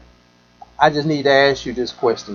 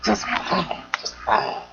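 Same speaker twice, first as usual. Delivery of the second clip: whisper; 3 semitones higher, 8 dB quieter, slower than usual. Retold or imitated. Doubtful friend's repeated answer.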